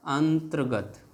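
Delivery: अंतर्गत said slowly, "aan-tar-gat"; drawn-out demonstration. Shown as a demonstration. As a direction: stretch the first part of अंतर्गत, then "tar-gat". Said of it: "हम इसका ऐसे उच्चारण कर रहे हैं". (अंतर्गत को खींचकर, धीरे "अं-तर्-गत")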